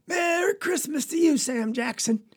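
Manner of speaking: high, sustained hail to a neighbor; calling out. Acted. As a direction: hail him high, then drop on the name. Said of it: "we heard Papa call out".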